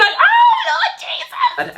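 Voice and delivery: very high, loud laughing "Oh, Lord Jesus"; laughing shriek. Retold or imitated. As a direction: shriek it through giggles. His hand brushes her thigh.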